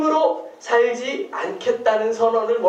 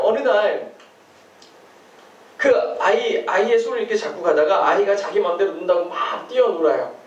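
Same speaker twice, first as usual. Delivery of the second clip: usual speaking voice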